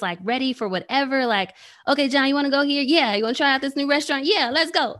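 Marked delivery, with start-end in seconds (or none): 1.9-5.0 higher, louder, cheerful back-and-forth; bright, eager mock dialogue; eager yes-man. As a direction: play the friend who says yes to everything. Friends asking easygoing John.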